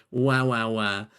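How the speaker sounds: ordinary talk, nothing marked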